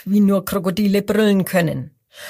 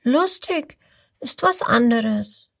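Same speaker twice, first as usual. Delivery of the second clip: little crocodile objecting; 4 semitones higher than usual. Acted. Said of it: "meint es".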